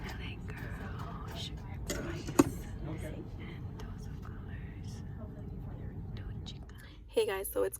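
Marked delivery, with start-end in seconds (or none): 0.1-6.6 whispering. Real, not acted. Late night filming, whispered.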